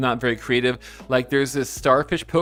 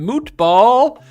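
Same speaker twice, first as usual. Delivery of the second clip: playful; 11 semitones higher, 8 dB louder, much slower than usual. Real, not acted. Playfully mangling a viewer name.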